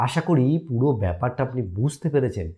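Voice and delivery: ordinary delivery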